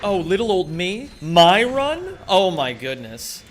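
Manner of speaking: coy, fluttery faux-innocent damsel; mock bimbo. Streamer mimicking self as the bimbo being fought over, coy mock-flattered. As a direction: play flattered and helpless, swooping up on the questions. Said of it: "like the fucking bimbo".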